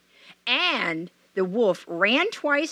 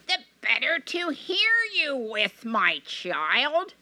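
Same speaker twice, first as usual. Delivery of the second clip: high sweet false granny voice; sugary old granny hiding a wolf; a wolf putting on a sweet granny voice; wolf as grandmother; 4 semitones higher, slower than usual. Acted.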